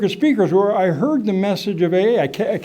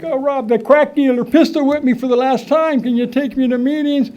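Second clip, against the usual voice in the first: higher, pleading brother voice; 8 semitones higher than usual. Retold or imitated. Brother Dave begging Rob for a lift, higher and pleading. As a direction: beg for a ride like a desperate brother. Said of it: "My brother Dave, like".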